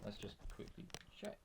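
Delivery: very quiet, almost whispered; hushed aside. Real, not acted. Commentator checking who is in the party, hushed aside. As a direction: murmur it under your breath, off the mic.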